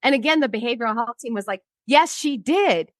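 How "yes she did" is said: drawn-out, higher-pitched insistence; insistent retort. Retold or imitated. Behavioral health team contradicting the accusers, insistent. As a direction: push back firmly, stretching the words.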